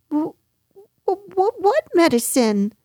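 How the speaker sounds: high, halting start to a question; alarmed stammer. A narrator's reading. Piglet hears about medicine from Kanga, stammering. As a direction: stammer before getting the question out.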